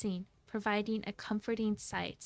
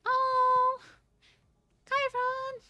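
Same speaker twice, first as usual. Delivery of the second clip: startled cry; 7 dB louder, much slower than usual. Acted. Character cries out to Chiron.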